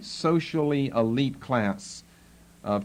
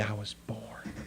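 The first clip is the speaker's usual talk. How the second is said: hushed, very soft and low; quiet; 4 semitones lower, 13 dB quieter than usual. Acted. Paul turning the tables on the captain, quietly. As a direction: say it almost under the breath. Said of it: "says quietly".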